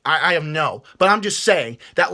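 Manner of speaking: ordinary delivery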